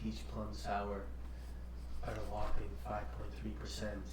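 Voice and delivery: near-whispered solemn verdict; hushed rating announcement. Acted. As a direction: announce the score in a low hush, pausing between phrases. Announces own beer rating.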